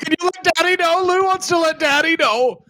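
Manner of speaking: very high, pleading wrestler voice; mock wail of a grown man desperate for his daddy's approval; hammed-up high whine to Daddy; crying daddy's boy. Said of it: "crying all the time about their daddies".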